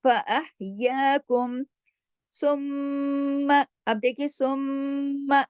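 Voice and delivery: slow, drawn-out Quran recitation; chanting the verse as a teacher's model; tajweed recitation. Shown as a demonstration. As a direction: recite it slowly with careful tajweed. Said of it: "मीम पे गुन्ना हुआ".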